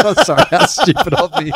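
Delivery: high, loud, laughing sarcasm; mock apology through laughter; sarcastic. Real, not acted. Mock apology after correction.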